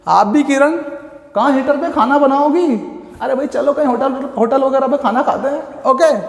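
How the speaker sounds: usual speaking voice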